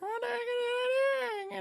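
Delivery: very high, drawn-out mock wail; imitated viewers. Streamer mimics viewers complaining about the setup, squeaky mock whine. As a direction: squeal it slowly as an outraged viewer. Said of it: "people are gonna be like".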